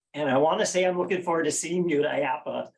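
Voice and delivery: ordinary talk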